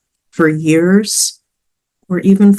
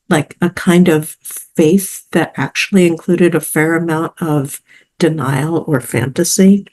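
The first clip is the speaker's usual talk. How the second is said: ordinary talk, nothing marked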